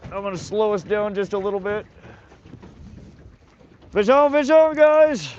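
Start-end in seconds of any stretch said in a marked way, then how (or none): 3.9-5.3 high, loud shout to viewers; excited shout. Real, not acted. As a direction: call out a fresh bite. Second fish hooked live.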